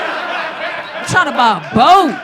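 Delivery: slow, high and loud, boat stretched; drawn-out yell. Acted. Comic insisting he wants a boat, slow loud yell. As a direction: bellow it like a demand. Comic yelling his wish.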